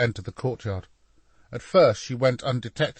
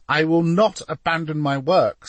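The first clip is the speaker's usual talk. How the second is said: higher, louder, emphatic declaration; emphatic refusal; 7 semitones higher, 6 dB louder than usual. A narrator's reading. Painter refusing to flee his work, emphatic. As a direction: refuse point-blank, with force. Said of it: "said an emphatic Leonardo".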